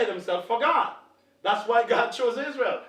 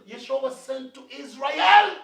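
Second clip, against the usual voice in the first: preaching shout; 3 semitones higher, 6 dB louder than usual. Real, not acted. Preacher declaring to the church, loud and forceful. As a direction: hammer it home to the congregation. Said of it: "preacher shouting point".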